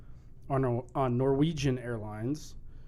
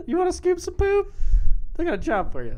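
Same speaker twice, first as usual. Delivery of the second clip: panicked homeowner; 10 dB louder than usual. Pretend. Mock scared homeowner.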